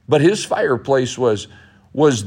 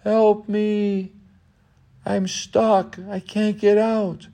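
put-on higher young boy's voice pleading for help; boy in the haymow calling for rescue, higher pleading voice; trapped boy; 8 semitones higher, slower than usual. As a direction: play the scared boy stuck in the fire.

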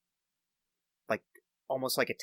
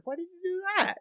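high-pitched whine. Acted. Voiced character protest.

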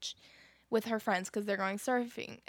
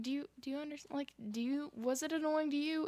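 ordinary delivery

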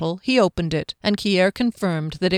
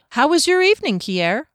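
higher, louder cheerful question; 8 semitones higher, 4 dB louder than usual. A narrator's reading. Fennel asking Kier about the evening, cheerfully. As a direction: ask brightly, pitch lifted at the start. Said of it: "Fennel asked cheerfully".

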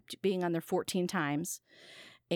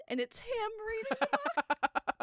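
high, slow, laughing through the words; slower than usual. Real, not acted. Delivering the record punchline to Jimmy, laughing helplessly. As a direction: squeak it out mid-laugh.

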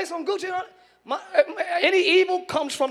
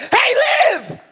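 high, loud excited cry; excited shout; 10 semitones higher, 7 dB louder than usual. Retold or imitated. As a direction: burst out with sudden excitement. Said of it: "I get excited and be like".

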